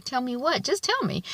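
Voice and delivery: high, insistent demand; pushing his girlfriend to spill it; Lawrence pressing her. Retold or imitated. Boyfriend demands his girlfriend tell him, mock insistent.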